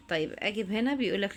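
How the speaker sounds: ordinary delivery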